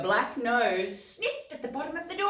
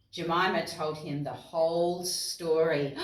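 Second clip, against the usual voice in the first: usual speaking voice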